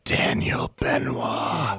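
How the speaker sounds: slow gravelly whisper; whispered name. Pretend. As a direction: whisper it, dragging it out slowly. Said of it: "he's really pushing".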